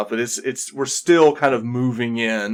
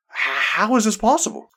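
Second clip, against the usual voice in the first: astonished; 9 semitones higher than usual. Acted. Elder amazed the party returned, high disbelieving question. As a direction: pitch jumps up in disbelief.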